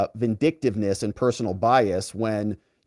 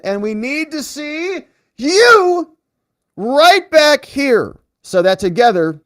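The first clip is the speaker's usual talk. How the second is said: high, loud, slowed-down rally cry of a closing pitch; rousing sign-off; 10 semitones higher, 6 dB louder, slower than usual. Acted. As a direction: stretch the words out like a big stage finale. Host's rousing show sign-off.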